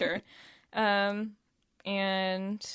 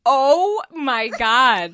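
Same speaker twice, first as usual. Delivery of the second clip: stunned; 3 semitones higher, 12 dB louder, much slower than usual. Real, not acted. Stunned by sad dream.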